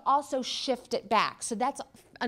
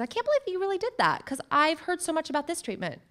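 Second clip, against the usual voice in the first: higher, louder, fast disbelief; incredulous acquaintance; 7 semitones higher, 4 dB louder, faster than usual. Retold or imitated. Imitating an acquaintance judging her treatment choice with higher shocked disbelief. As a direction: mimic a nosy friend's shock.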